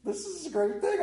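high, delighted; light up with joy. Retold or imitated. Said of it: "And I went".

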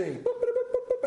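high-pitched little boops; boat noises. Acted. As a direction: imitate boats puttering along in high boops. Imitates boats sailing along.

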